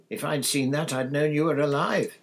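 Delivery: high, loud, wailing voice; agitated. A narrator's reading. Wife who had feared her husband dead, agitated. Wife upset about news report.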